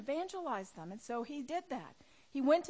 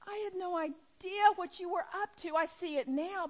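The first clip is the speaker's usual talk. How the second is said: higher, pleading realization; ashamed plea to God; 6 semitones higher than usual. Pretend. Ashamed believer before God, pleading. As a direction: confess to God with sudden shame.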